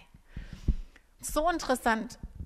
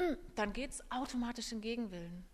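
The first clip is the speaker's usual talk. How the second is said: ordinary talk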